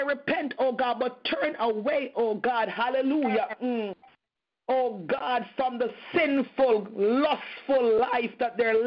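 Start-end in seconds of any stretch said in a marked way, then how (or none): none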